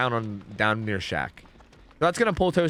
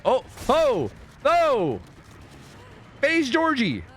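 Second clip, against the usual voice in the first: excited; 11 semitones higher, much slower than usual. Real, not acted. Sudden excitement at a play.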